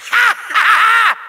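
short triumphant cackle; high villainous ha-ha-ha; evil laugh. Acted. Villain celebrates his lead, triumphant cackle.

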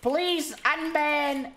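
high, drawn-out mock sobbing plea; hammed-up despairing whine; whining lament. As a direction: beg in a slow, exaggerated wail. Laments the ban and begs.

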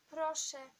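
understated stifled-sob plea; choked plea. A narrator's reading. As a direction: plead with a lump in the throat. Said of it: "stłumiony szloch schwytał ją za gardło".

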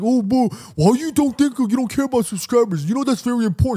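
high-pitched, whiny mock nagging; mock yelling co-host. Podcaster mimicking his co-host scolding him, high mock yelling. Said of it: "you always yell at me all the time".

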